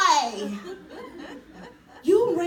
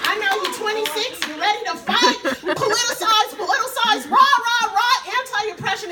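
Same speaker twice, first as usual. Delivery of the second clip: higher, louder playful chant; mock rally cry; 6 semitones higher, 7 dB louder than usual. Real, not acted. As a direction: tease yourself like a cheerleader for the cause. Mocking her activist adult self.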